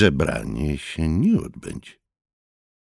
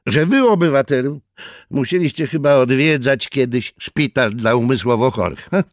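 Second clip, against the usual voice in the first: hostile jab; 8 semitones higher, 6 dB louder than usual. A narrator's reading. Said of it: "postanowiwszy wypowiedzieć nieproszonemu rozmówcy wojnę".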